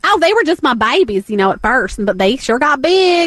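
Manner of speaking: lightly put-on Southern mama; sweet, clueless Southern lady; Southern accent. Tiger lady character.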